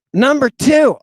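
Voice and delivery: high, loud and emphatic; loud emphatic announcement. Retold or imitated. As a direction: announce it loudly, alarmed. Nama reporting a second runaway.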